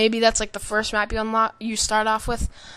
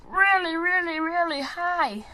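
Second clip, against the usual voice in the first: much higher, slow odd voice; weird voice; 9 semitones higher, slower than usual. Real, not acted. Gamer describing a high-flying plane, slow high odd voice. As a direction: stretch it out high and strange. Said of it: "I don't know why I'm talking so weird".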